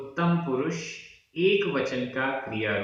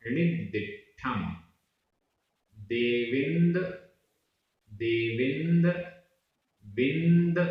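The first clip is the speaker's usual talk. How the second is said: Prakrit verse read out slowly, word by word; teacher sounding out a Prakrit verse; slow syllable drill; slower than usual. Shown as a demonstration. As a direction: drill the verse one word at a time for students to repeat. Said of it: "उच्चारण।".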